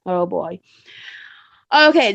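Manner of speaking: ordinary delivery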